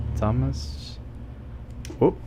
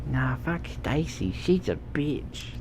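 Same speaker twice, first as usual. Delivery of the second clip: quiet quote; 7 dB quieter than usual. Retold or imitated. Quoting a line in a low voice.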